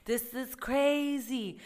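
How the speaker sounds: slow, drawn-out disbelief; incredulous. Retold or imitated. Telling the customer off, slow and incredulous. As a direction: stretch it out in shock. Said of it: "And I was like".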